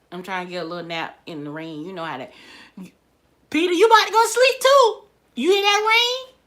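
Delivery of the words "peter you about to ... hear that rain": loud, high sing-out questions; calling to Peter. Real, not acted. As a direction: pitch way up, calling over to a sleepy companion. Turns aside to talk to Peter.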